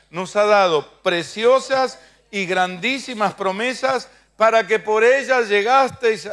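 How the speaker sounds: loud, slow scripture proclamation; preaching at full force. Acted. Preacher reads Pedro to the congregation, loud and slow. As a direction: proclaim the verse to the whole church.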